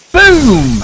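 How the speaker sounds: loud, high booming shout; explosion. A narrator's reading. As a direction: blast it out like an explosion. Dynamite explodes.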